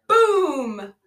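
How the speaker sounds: loud, high, drawn-out boom; mock explosion. Retelling the car blowing up, loud imitated explosion. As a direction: imitate a blast with a big falling boom.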